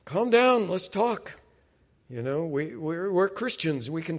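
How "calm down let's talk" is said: high, loud call over an argument, easing off at the end; acted raised-voice appeal, settling down; calling for calm. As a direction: break up a family row. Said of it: "sometimes if there's an angry thing".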